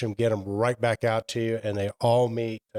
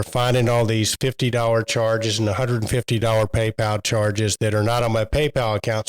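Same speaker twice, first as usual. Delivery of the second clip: ordinary delivery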